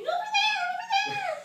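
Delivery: very high, loud panicked scream. Acted. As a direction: shriek a warning from the corner. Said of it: "you know, screaming".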